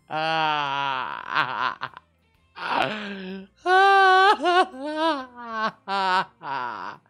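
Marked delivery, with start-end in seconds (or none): 2.5-5.8 laugh-sob. Real, not acted. Drunk laugh-cry.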